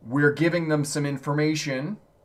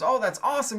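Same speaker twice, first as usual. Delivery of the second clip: lightly voiced New Yorker, pitched up and bright-eyed; New Yorker's quote; 10 semitones higher than usual. New Yorker greeting the Chicago guy, lightly voiced, pitched up. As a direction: chirp it like an eager newcomer. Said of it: "he says".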